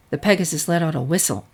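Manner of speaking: usual speaking voice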